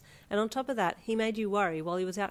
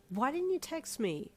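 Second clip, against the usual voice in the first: sadly; 7 semitones higher, slower than usual. A narrator's reading. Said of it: "you asked sadly".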